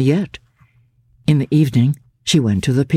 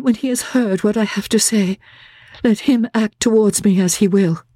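put-on higher, gentle young woman pleading with resolve; a timid girl gathering her courage, voice lifted higher; Laura's earnest plea; 8 semitones higher than usual. A narrator's reading.

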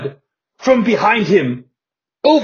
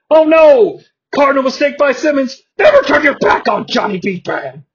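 excited commentator; 7 semitones higher, 4 dB louder than usual. Acted. Commentator reacts to a ringside attack.

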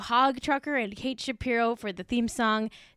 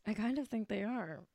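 usual speaking voice

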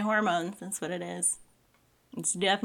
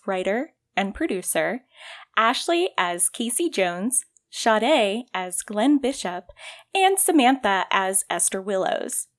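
announcer read; 5 dB louder than usual. Acted. Podcast credits read.

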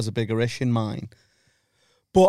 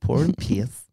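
gentle Northern Irish power and pace; a soft, unthreatening Northern Irish lilt; soft Northern Irish accent; slower than usual. Shown as a demonstration. Repeating his Northern Irish phrase to a friend. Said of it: "your Northern Irish".

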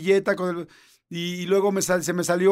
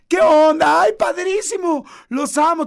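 much higher and louder, over-the-top delight; hammed-up sweetness for friends at a restaurant; gushing friendly greeting; 9 semitones higher, 6 dB louder than usual. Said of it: "ven a alguien en un restaurante y es".

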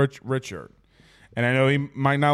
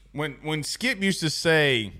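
usual speaking voice

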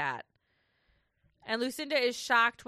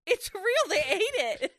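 high, slow, stressed disbelief; outraged disbelief; 10 semitones higher, 3 dB louder than usual. Real, not acted. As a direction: drag it out in disbelief. Outraged at wasted paella.

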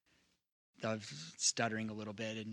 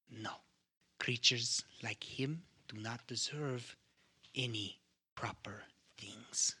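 slow, hissing whispered accuser; a cold hunter whispering slowly; 3 dB quieter than usual. Acted. GM voices the hunter again.

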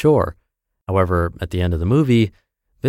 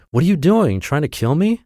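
infuriated scream; 8 semitones higher than usual. A narrator's reading. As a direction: yell at the man who nearly drowned you. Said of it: "the young man screamed".

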